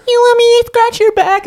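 put-on high sweet baby talk; cutesy baby voice. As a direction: coo the offer, teasing. Imitating girlfriend.

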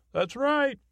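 high-pitched interjection in another voice; put-on character voice. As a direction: chime in as a high-voiced sidekick. Other character answers the host's remark.

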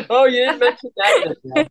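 high, laughing, drawn-out tease. Real, not acted.

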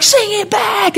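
half-shouted stage command; commanding call. Retold or imitated. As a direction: demand they sing back. Jeffrey commanding the crowd.

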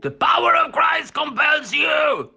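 high shouted Swedish exorcist; Stellan Skarsgård. Acted. Swedish listener casting out the demon, high Swedish-accented shout. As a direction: cry it out slowly, full voice, Swedish accent. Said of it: "as they say it like Skarsgård".

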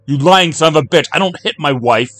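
loud high furious shout; outraged yell. Acted. Jack yelling at Kino, furious shout. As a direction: roar the denial.

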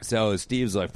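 ordinary talk, nothing marked

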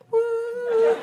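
high, softish mock "woo"; thin, high token cheer; feeble cheer. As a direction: imitate a half-hearted audience whoop. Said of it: "their cheers sound less empowered and less feminist".

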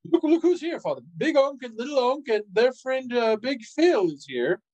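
high, overly cheerful babble; nervous cover-up. Acted. Son to father, hiding his suspicion, fake cheerful. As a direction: paper over the awkwardness with fake cheer.

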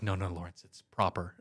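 a hint of a prim mother, low and soft; mock prim mom. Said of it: "And she's like".

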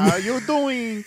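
high, slow mock flirting; flirty come-on. Co-host echoes the catcall, teasingly flirty. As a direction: echo the come-on, stretching every word.

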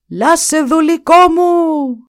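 very high, loud, slow drawn-out howl. Acted. The Duke howls for Lasse a third time, very high and slow. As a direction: howl it with all his strength. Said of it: "ούρλιαξε για τρίτη φορά".

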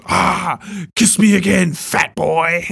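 sneering Pennywise-style taunt; taunting clown. Retold or imitated. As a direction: tease him like a gleeful evil clown. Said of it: "And Pennywise goes".

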